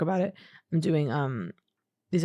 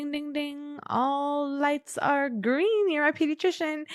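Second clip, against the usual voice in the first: high, slow sing-song chant; high chanting delight; sing-song glee; 9 semitones higher, slower than usual. Retold or imitated. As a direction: chime it out like a game show win. Sing-song glee at getting invited.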